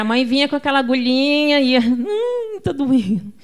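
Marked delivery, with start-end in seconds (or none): 1.8-3.3 put-on high-pitched whimper of a child; child in pain. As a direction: whine as the needle comes near. Voicing child as needle approaches. (criança gemendo de dor)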